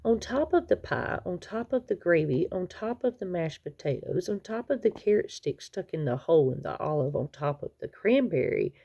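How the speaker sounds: ordinary delivery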